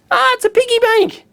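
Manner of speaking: high-pitched burst of real delight; delighted surprise. Real, not acted. Vlogger discovering a gift in viewer mail, delighted surprise. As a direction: exclaim it high, thrilled by the find.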